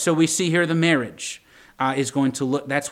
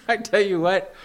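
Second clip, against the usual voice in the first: laughing; 5 semitones higher than usual. Real, not acted. Laughing at the memory.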